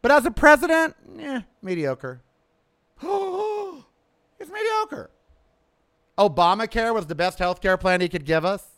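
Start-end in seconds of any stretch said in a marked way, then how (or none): 3.0-5.1 gasping, very high and slow in mock horror; mock shocked gasp. Vlogger mocking the left's outrage, gasping very high and slow. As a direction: clutch your pearls and drag it out.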